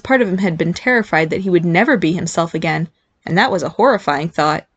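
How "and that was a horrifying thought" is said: usual speaking voice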